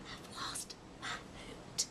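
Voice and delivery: slow, breathless whisper. Acted. Owlet whispering her worry to the mouse. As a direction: whisper it like a lost secret. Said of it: "whispered Ola".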